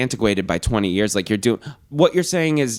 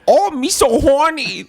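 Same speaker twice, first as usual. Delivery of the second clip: a crude mocking caricature, pitched way up; 5 dB louder, slower than usual. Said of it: "if you went to Chinatown and went".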